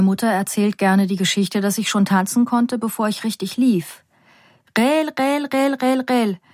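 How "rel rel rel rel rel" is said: chanting dance count, higher; mother calling rhythm. Retold or imitated. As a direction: call the dance beats like a mother. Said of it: "dirigierte meine Mutter". (Mutter ruft den Tanztakt)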